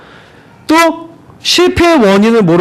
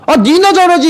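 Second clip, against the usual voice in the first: mock snippy comeback, pitched up; defensive retort; 8 semitones higher than usual. A person scolded firing back at the critic, higher and sulky.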